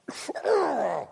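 high-pitched fake crying howl; mock wail. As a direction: wail in hammed-up despair. Mock despair over sold-out toy.

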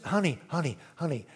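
quiet soothing reassurance; soothing wife. Retold or imitated. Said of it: "You know, just".

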